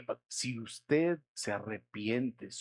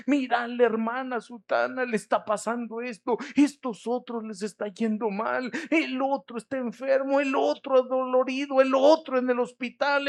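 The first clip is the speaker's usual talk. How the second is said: prayer rising higher and louder line by line; building anguished prayer; 9 semitones higher, 5 dB louder than usual. Retold or imitated. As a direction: plead for each suffering one, building to a cry. Pastor voices his own prayer.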